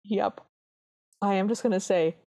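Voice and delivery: ordinary talk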